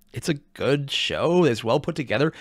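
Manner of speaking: usual speaking voice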